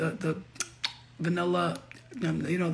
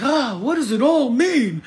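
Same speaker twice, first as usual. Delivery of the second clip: higher, louder, slow mock bewilderment; baffled future reader; 6 semitones higher, 8 dB louder, slower than usual. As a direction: ham up a confused reader scratching their head. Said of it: "they're gonna be like".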